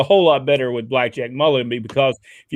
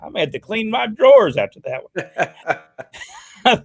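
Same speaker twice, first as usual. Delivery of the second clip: high-pitched shock breaking into laughter; just got a fright, laughing it off; real startled; 8 semitones higher than usual. Real, not acted. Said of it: "that scared me a little bit".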